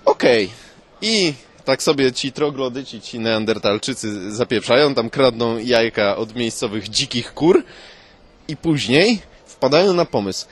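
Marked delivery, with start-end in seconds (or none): none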